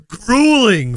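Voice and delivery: "Grueling" stretched very long, loud and swooping; hitting GRUELING again. Shown as a demonstration. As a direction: punch "Grueling" with a drawn-out swell. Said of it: "Let me hit that word again".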